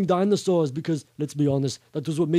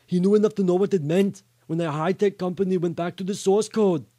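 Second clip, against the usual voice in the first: plain reading voice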